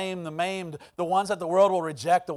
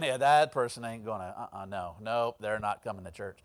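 low, quiet dismissive mutter; low-voiced, muttered rejection; dismissive doubter; 10 semitones lower, 5 dB quieter than usual. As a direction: write them off under your breath in a low voice. Voicing doubters writing someone off.

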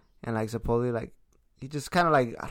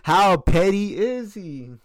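mock host voice; 6 semitones higher, 8 dB louder, much slower than usual.

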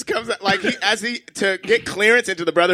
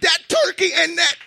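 heated shout; 8 semitones higher than usual. Real, not acted. Defending mayonnaise to his friend, shouting. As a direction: yell it over the other guy.

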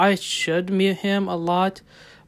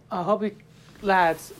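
a hint of Scottish on "lads"; Scottish accent. Real, not acted. Podcaster signing off to listeners in a light Scottish accent. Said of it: "I don't know why I say Scottish way".

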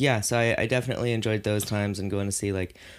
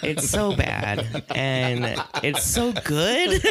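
slow, drawn out through laughter; laughing; 9 semitones higher, 3 dB louder, much slower than usual. Real, not acted. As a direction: stretch it while laughing. Laughing about guilty pleasure.

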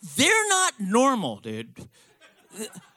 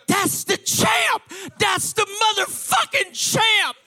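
high, loud, slow drawn-out holler; awestruck shouting; 10 semitones higher, 6 dB louder, slower than usual. Acted. Shoe shiners spotting the champ, excited high shout. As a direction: holler it across a crowd.

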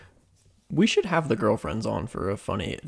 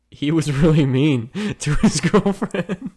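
louder, laughing through a quote; 4 semitones higher, 6 dB louder, slower than usual. Retold or imitated. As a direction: giggle through the words. Retold friends' reaction, laughing.

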